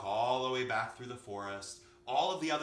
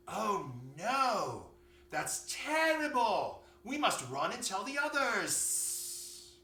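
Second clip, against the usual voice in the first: high, slow, horrified snake voice; storybook snake in a tizzy; alarmed snake; 9 semitones higher, 4 dB louder, slower than usual. A narrator's reading. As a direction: draw out each word in dramatic dismay. Snake reacts in horror.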